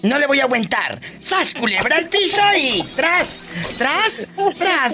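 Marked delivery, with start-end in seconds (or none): 1.2-4.9 high, slow, ringing call; catchphrase cry. Acted. Host cheering on the caller with her signature cry, high and slow. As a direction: belt it out like a radio hype shout.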